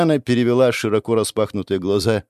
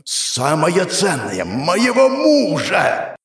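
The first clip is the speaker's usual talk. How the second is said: slow furious shout; 7 semitones higher, much slower than usual. Acted. Goddess shouts at Adam.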